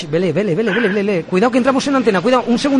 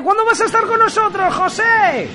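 bright, raised on-air host voice; radio announcer; 12 semitones higher than usual. Acted. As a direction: sell it like a live radio host.

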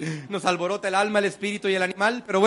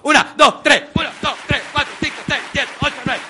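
loud, higher counting call; 6 semitones higher, 6 dB louder than usual. Real, not acted. Motivational speaker counting hugs for the audience, loud.

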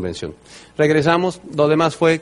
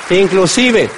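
ordinary delivery

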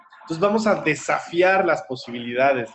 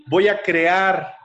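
higher, slow, stretched declaration; forceful affirmation; 6 semitones higher, 3 dB louder, much slower than usual. Retold or imitated. Coach has the girl repeat, forceful slow declaration. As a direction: proclaim it, drawing out CREAR.

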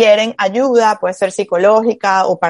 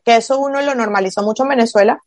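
normal talking voice

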